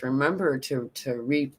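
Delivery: normal talking voice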